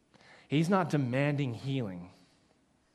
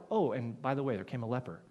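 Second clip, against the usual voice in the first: casual and flat, dropping lower and softer; offhand aside; 6 semitones lower, 5 dB quieter, faster than usual. Retold or imitated. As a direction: toss it off like it's nothing. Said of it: "It's not like".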